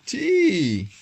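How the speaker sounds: loud, high, drawn-out "tea"; gleeful exclamation. A narrator's reading. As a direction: call it out high with relish. Gleeful punchline of Comp's thought.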